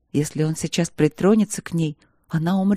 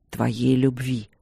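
a dragon growling his demand for love; 5 semitones lower, slower than usual. A narrator's reading. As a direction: lower and slow, a rough dragon growl. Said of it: "ответил он с рычанием".

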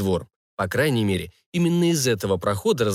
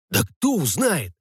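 slow, higher-pitched brush-off; confident; 6 semitones higher, slower than usual. A narrator's reading. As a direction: dismiss your accomplice's worry with assurance. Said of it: "уверенно ответил ему тот". (уверенно, растягивая, повышенным тоном)

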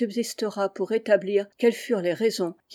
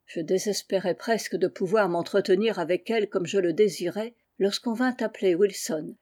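ordinary delivery, nothing marked

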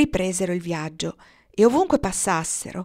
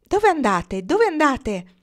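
high, loud call, higher the second time; raised-voice question; 7 semitones higher, 6 dB louder, slower than usual. A narrator's reading. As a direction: call up to someone passing. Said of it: "si fermarono e chiesero".

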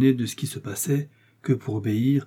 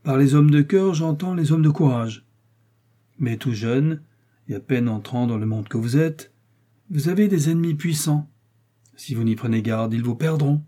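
raised, louder voice of a commanding cardinal; authoritative cardinal voice, pitched up; 5 semitones higher, 6 dB louder than usual. Acted. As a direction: speak down to the young man as the cardinal. Cardinal continues speaking.

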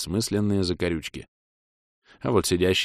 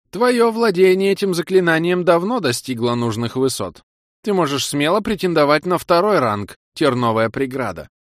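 put-on old man voice; 6 semitones higher, 4 dB louder than usual. A narrator's reading.

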